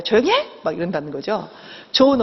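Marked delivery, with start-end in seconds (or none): 0.0-0.5 higher, sharp mock command to hush; bad mother's raised-voice reprimand; scolding bad mother. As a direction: shut the child up, acted. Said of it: "짜증내면 어때요".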